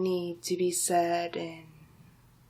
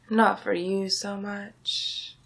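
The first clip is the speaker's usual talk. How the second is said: ordinary delivery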